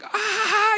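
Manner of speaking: high, mock scream of joy; imitated scream. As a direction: let out a short ecstatic yelp. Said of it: "I'm screaming".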